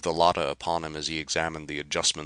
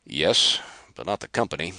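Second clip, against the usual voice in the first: disdainful; 3 semitones higher, 6 dB louder than usual. Acted. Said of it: "Carr was disdainful".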